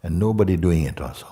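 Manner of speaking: hushed meditation voice with long pauses; guided meditation. Real, not acted. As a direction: guide them into emptiness, leaving long silences.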